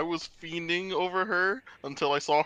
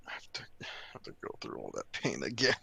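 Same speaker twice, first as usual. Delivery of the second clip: low, fast weary whisper; whispered groan; 13 semitones lower, 7 dB quieter, faster than usual. Real, not acted. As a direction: sigh it out under your breath. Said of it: "weary complaint".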